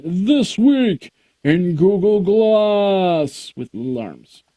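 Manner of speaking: slow, drawn-out silly show sign-off; lightly put-on announcer voice; mock announcer sign-off. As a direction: ham up the outro like a cartoon announcer.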